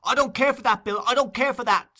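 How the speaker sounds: high, loud scream of defiance. Acted. Nancy screaming at Bill while struggling, loud. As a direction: scream it at him. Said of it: "screamed the girl".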